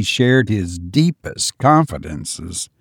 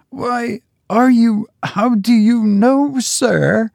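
hoarse whisper; 11 semitones higher than usual. A narrator's reading. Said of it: "in a hoarse whisper".

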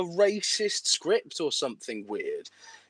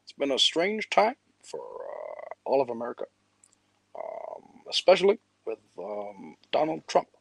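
halting, lower-pitched presidential impression; Barack Obama; 7 semitones lower, 4 dB quieter than usual. Shown as a demonstration. Demonstrating an impersonation for his co-host, in a halting presidential voice. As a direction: imitate a president, soft with long pauses and uhs. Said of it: "do an impersonation of someone specifically".

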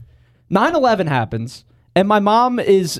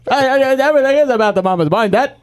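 high, fast mumble with stammered uhs; hammed-up unintelligible old man; mumbling uncle voice; 7 semitones higher than usual. Imitating uncle's mumbling speech.

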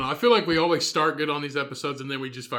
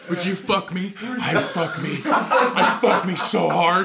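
hammed-up seductive voice; 3 semitones higher, 4 dB louder, slower than usual. Mocking friend dancing in mirror.

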